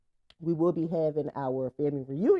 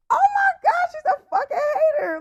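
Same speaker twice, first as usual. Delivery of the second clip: very high, loud, fast laughing shriek; hammed-up teen meltdown over the haircut; mock wail; 8 dB louder than usual. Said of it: "Like, I cried".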